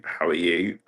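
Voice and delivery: ordinary talk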